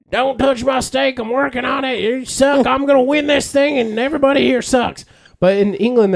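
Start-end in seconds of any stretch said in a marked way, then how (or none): 0.1-5.1 competitive American griller. Retold or imitated. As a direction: play a petty cook-off rival. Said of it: "they'd be like".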